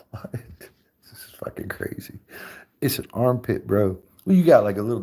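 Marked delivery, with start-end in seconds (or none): 0.1-2.2 breathy under-the-breath whisper; hushed shocked aside. Real, not acted. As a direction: whisper it to yourself in disbelief.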